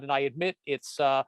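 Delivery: usual speaking voice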